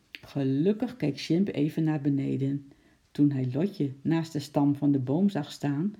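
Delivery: ordinary delivery, nothing marked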